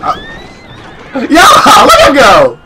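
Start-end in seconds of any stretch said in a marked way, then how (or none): 1.3-2.6 loud whooping shout; excited holler. Real, not acted. Player cheering on the action, loud excited holler.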